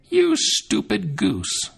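high, very slow scornful witch voice; a witch sneering at the girl. A narrator's reading. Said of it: "the old woman said".

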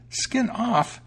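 very high, astonished mouse echo; exclaiming mouse. A narrator's reading. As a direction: squeal the name back in disbelief. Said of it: "exclaimed the mouse".

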